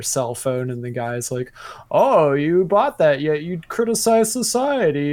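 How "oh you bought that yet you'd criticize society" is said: smug meme guy. Retold or imitated. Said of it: "that classic meme".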